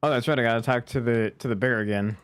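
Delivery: usual speaking voice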